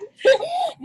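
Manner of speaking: sudden high emotional laugh; high burst of laughter. Real, not acted. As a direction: let a laugh break out. Laughs emotionally mid-sentence.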